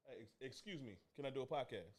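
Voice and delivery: usual speaking voice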